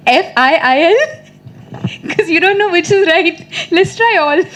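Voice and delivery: high, giggly delivery; laughing. Real, not acted. Recalling her spelling guesses at school, amused and laughing. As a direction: laugh through your own silly confession.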